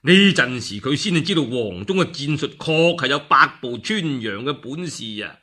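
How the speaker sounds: ordinary delivery, nothing marked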